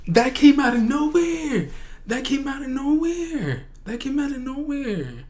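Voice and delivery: high-pitched excited disbelief, fading in volume; overwhelmed squeal. Real, not acted. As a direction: squeal it repeatedly, losing force each time. Overwhelmed fan reacts, cannot calm down.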